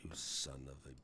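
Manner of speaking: whispered mock insult, cut off; a playful whispered jab; joking whisper. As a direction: breathe the insult, then stop short.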